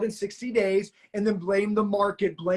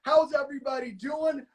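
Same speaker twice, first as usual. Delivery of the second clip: normal talking voice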